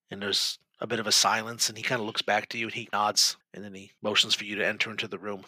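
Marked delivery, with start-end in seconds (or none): none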